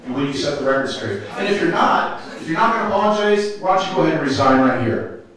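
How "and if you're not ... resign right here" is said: louder and fast, higher at first, pressing the demand; angry demand. Real, not acted. Resident demanding the president resign, angry and fast. Heated demand at public meeting.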